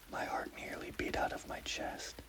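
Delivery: usual speaking voice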